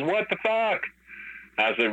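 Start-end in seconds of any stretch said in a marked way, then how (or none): 0.0-0.8 retold disbelief. Caller phoning after hearing of the fight, incredulous. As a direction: act out a stunned caller.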